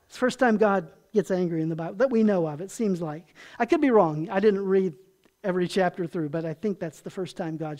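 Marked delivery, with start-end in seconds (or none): none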